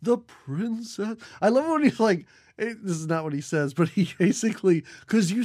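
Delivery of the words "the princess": put-on slow, halting old man voice. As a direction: drag out the words like a frail old man. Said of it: "this old guy who can barely deliver his lines".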